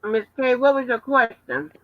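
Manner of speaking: talking through a mouthful of food; words round a bite of food; mouth full. Real, not acted. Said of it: "I got food in my mouth".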